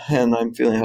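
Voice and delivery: ordinary delivery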